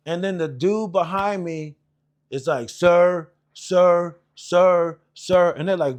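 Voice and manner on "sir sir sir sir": loud, repeated call for attention; imitated urgent calling. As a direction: call out each word separately, insistently. Recruit behind calls for attention.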